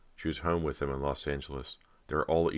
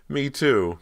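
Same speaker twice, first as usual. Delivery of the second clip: much higher and louder, eager; eager cry; 11 semitones higher, 9 dB louder than usual. A narrator's reading. Cody answering her sister, crying out eagerly. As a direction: burst out with joy. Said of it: "Cody cried".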